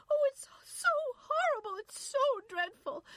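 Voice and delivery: very high, loud sobbing wail; hysterical, tearful outcry. A narrator's reading. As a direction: sob it out in high anguish. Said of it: "Irene was sobbing".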